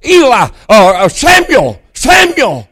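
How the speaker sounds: high, ringing call of a name. Acted. The voice calls Samuel a third time, calling out. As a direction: call the name out, falling at the end.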